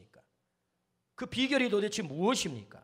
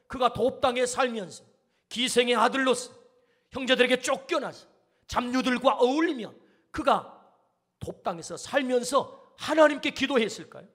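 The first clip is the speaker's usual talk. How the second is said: usual speaking voice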